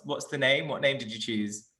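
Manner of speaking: ordinary talk, nothing marked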